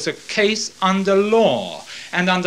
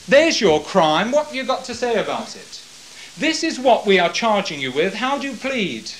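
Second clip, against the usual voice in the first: accusing judge; 6 semitones higher, 4 dB louder than usual. Acted. Caiaphas demands Jesus answer the charge, higher and pressing. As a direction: press the prisoner hard to plead.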